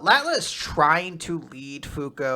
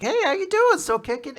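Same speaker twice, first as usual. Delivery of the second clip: cheery doctor; 13 semitones higher, 4 dB louder than usual. Retold or imitated. Doctor greeting sick little girl.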